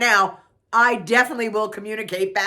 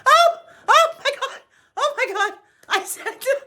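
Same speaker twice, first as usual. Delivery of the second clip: high, loud, helpless laughter; real laughter breaking through; laughing hard; 4 dB louder than usual. Real, not acted. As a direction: gasp it out in fits of laughter. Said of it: "I'm still laughing".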